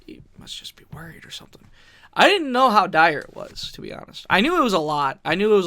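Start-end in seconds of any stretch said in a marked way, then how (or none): 0.1-1.6 fast unvoiced whisper; a hushed inner voice; whispered thought. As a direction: whisper the worry to yourself, quickly. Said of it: "I'm like".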